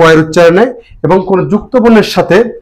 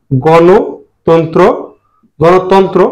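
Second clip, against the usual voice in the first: slow careful reading; much slower than usual. Shown as a demonstration. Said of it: "teacher reads list of words slowly".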